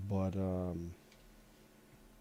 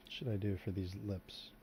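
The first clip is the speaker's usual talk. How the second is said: ordinary talk, nothing marked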